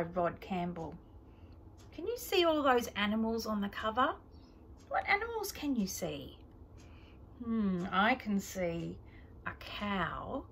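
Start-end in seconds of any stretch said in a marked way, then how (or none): none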